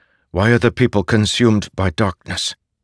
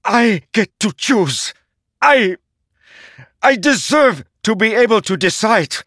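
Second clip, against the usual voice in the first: very high, loud, desperate shrieking; shrieking at the herald who forged him; anguished shriek; 5 dB louder than usual. A narrator's reading. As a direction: tear it out of him in despair. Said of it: "Szeth shrieked".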